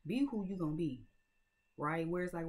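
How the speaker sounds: ordinary talk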